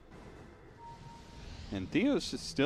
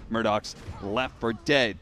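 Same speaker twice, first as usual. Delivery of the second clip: ordinary delivery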